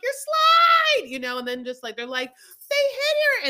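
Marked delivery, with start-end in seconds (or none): 0.0-1.1 much higher, louder squeal; shrieking alarm. Retold or imitated. Sister shrieking about a kicked-off slide, mock panic. 2.7-3.5 much higher, louder yell; mock accusing shout. Family yelling that the car hit her, mock shout.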